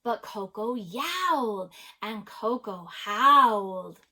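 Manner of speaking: animated, emphatic storybook narration; story read-aloud. A narrator's reading. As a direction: read it to children, punching the action words. Reads a picture book aloud.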